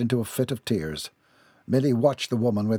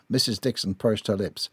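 plain reading voice

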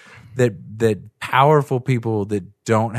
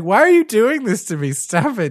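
high, pleading whine of a victim; whiny mock plea; 11 semitones higher than usual. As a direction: whine like a bad actor begging. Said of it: "he's like".

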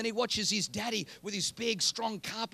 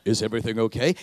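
lower, booming voice of God; 11 semitones lower, 8 dB louder, faster than usual. Pretend. God asking Joseph and Mary if all is well, low and booming. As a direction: speak down as God, weighty and low.

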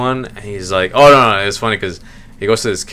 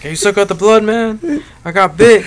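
high-pitched imitation of begging; playful desperate request; mock pleading; 11 semitones higher, 3 dB louder than usual. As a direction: plead with a friend, hammed-up. Acted plea in a retold video.

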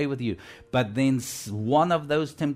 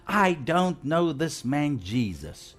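slow, emphatic retold denial; cursing denial; 3 semitones higher, slower than usual. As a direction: deny it word by word like Peter swearing. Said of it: "he cursed".

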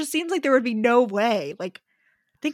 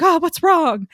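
higher, louder anxious question; mock alarm; 6 semitones higher, 6 dB louder, slower than usual. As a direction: act out bursting in worried. Retold worry on arriving upstairs.

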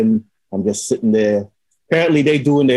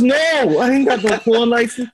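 high, worked-up comic answer; retold exasperation; 10 semitones higher than usual. Telling the second cop he has no license, high exasperated voice.

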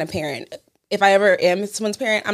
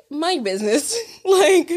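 laughing retort; 5 semitones higher, slower than usual. Real, not acted. Mocking dad, laughing.

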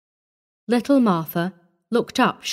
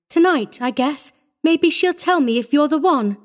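lightly voiced shy little girl, higher-pitched; 7 semitones higher than usual. A narrator's reading. Orphan girl saying she must ask Mommy, shy. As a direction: add it shyly and haltingly in a child's high voice. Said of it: "she added shyly".

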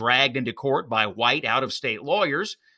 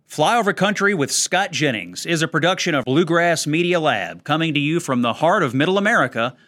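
louder upbeat podcast promo read; announcer read; 4 dB louder than usual. Acted. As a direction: read it like a radio sign-off promo.

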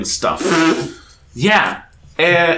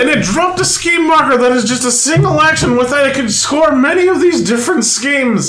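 lightly voiced old-timey football announcer; sports announcer voice; 7 semitones higher than usual. Shown as a demonstration. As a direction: announce it like a TV color commentator. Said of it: "I'm not sure what that voice is. I apologize for that impression".

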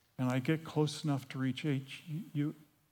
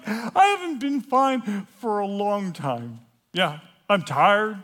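loud, raised confession coming down; anguished outburst; 4 semitones higher, 7 dB louder than usual. Acted. Confessing to the room after yelling, loud and anguished. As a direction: blurt it out loudly, worn out.

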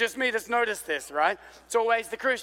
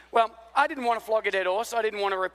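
ordinary talk

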